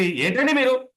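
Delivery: high, loud irritated snap; pitch jumps up. Shown as a demonstration. A trainer showing how irritation wrecks the pitch, high and loud. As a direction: let the pitch fly up in annoyance. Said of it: "చూడండి pitch ఎక్కడికి పోయిందో".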